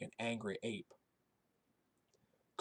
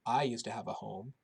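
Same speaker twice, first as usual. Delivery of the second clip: ordinary delivery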